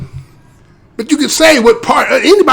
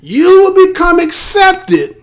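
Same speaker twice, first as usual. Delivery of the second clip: drawn-out, higher, emphatic declaration; slow, raised pitch to drive ACCEPTED home; emphatic repeat; 7 semitones higher, 3 dB louder, slower than usual. Real, not acted. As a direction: say it again slowly, leaning on ACCEPTED. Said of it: "I have to say that again".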